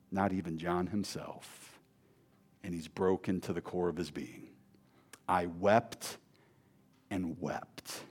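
low, soft, grieving hush; hushed sorrow. Acted. Preacher dwelling on John's weeping, low and hushed. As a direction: let the words sink into grief.

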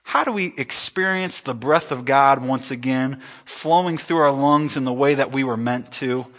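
ordinary talk, nothing marked

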